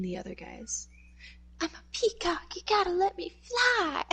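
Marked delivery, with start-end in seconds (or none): 1.6-4.0 higher, pushed Mark Wahlberg line; a hammed-up Mark Wahlberg, pitched up; Mark Wahlberg impression. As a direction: squeak it out like Wahlberg in The Other Guys. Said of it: "that's exactly who you sound like. Mark Wahlberg".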